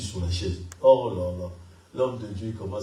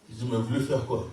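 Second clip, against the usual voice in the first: usual speaking voice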